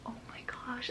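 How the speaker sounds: soft whisper of wonder; real hushed awe; awed whisper. Real, not acted. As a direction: whisper it, spellbound. Awed by new leaf.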